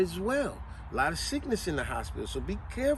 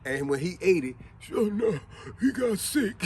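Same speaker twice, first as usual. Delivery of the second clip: ordinary delivery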